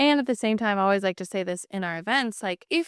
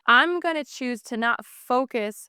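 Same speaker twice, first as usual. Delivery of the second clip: ordinary talk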